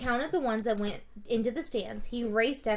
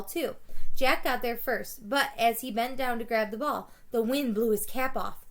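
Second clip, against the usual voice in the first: usual speaking voice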